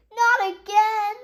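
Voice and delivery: loud, drawn-out shout of dismay; both parents yell it at once; exasperated group yell; yelled together. Acted. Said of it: "they yelled together".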